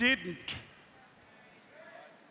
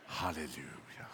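hushed hallelujah; 12 semitones lower, 10 dB quieter than usual. Real, not acted. Whispered reverent hallelujah.

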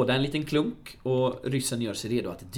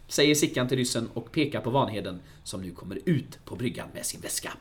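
ordinary delivery

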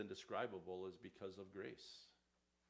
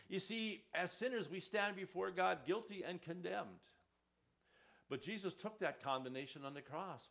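preaching at full force; 7 semitones higher, 6 dB louder than usual. Acted. Sermon climax.

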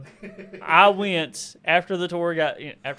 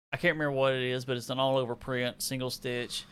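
normal talking voice

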